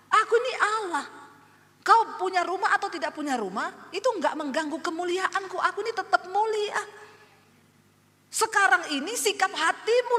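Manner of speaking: high, loud commanding divine voice; voice of God. Retold or imitated. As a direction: proclaim with full authority. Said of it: "Tuhan bilang".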